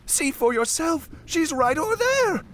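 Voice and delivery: high, loud insistence; insistent high-pitched boy; protesting young deckhand. A narrator's reading. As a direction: urge him to look, high and urgent. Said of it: "the young deckhand protested".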